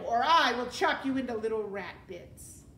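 loud, forceful warning; threat. A narrator's reading. Mig threatening the rat with the knife, loud. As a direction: threaten him at full voice.

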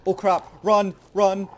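panicked shout, high and loud; being chased. Real, not acted. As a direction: yell to flee in real panic.

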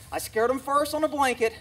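ordinary talk, nothing marked

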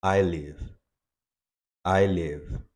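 ordinary talk

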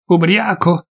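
high, indignant echo; angry retort. Acted. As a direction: throw the word back at him. Peasant throws word back.

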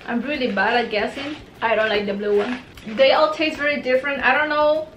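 candy in mouth; grimacing mid chew; talking while chewing. Real, not acted. Seen 2: Candy put in mouth at -1; grimacing, mouth working throughout labelled words.

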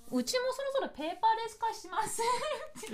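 very high, small wheedling voice; squeaky mock request; mock pleading suggestion. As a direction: pitch it way up like a playful plea. Mock plea while hunting for paper.

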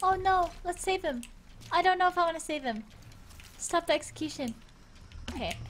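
ordinary talk, nothing marked

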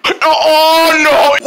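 high, loud panicked cry; panicked yelling. Acted. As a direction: yell it out in alarm.

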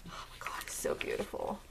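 whispered in hushed admiration; real whisper, very low in volume; awed whisper. Real, not acted. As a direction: breathe it out in a soft whisper. Awed by what she is looking at.

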